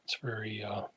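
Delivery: drowsy, half-asleep murmur; drifting off to sleep mid-sentence; nodding off. Real, not acted. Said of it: "as you can tell, I'm falling asleep".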